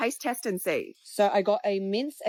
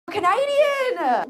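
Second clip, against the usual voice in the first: high, slow, stretched-out call of Canadian; excited exclamation; 11 semitones higher, 7 dB louder, much slower than usual. Real, not acted. Comic calls out audience member's homeland, drawn out. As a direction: draw out Canadian like a big reveal. Host calling out audience's answer.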